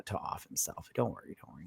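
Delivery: soft whispered reassurance; mock creepy whisper; sinister whisper. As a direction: hush it like a sly plotter. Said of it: "And he's like".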